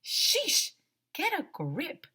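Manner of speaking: high, exasperated outburst; loud, high sheesh of impatience. A narrator's reading. Narrator scorns the scared kids.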